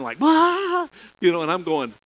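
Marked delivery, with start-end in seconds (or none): 0.2-0.9 a hammed-up wail, much higher and louder; mock bawling burst, thrown up high; imitated crying wail. College girl bursting into tears in the pastor's office, hammed-up wail. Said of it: "she just bust out crying".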